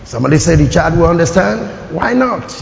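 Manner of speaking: normal talking voice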